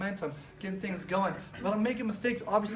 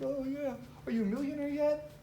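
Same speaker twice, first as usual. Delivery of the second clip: higher, teasing mock voice; mocking friends; 6 semitones higher, 3 dB quieter than usual. Friends mocking his dream, in a higher teasing voice. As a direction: tease him like a smug friend. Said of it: "they would make fun of me".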